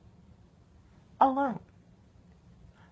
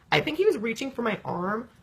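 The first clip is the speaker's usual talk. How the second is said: ordinary delivery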